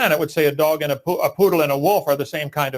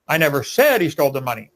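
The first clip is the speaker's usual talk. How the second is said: "said" stretched and punched, rest quicker; stress on SAID; 2 semitones lower than usual. Shown as a demonstration. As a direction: hit the word "said". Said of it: "By just emphasizing different words".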